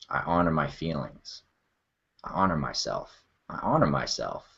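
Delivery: soft, hushed and unhurried, naming each feeling; emotional check-in. Shown as a demonstration. Demonstrating the check-in to viewers, soft and sincere. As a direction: drop into your body and confess each feeling gently. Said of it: "So try that with your friends. It's called the check-in".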